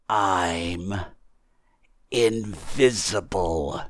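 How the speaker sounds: very slow, spaced-out declaration; patient, measured disembodied voice; deliberate unseen voice. Acted. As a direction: spell it out with weighty pauses. Voice spells out the point slowly.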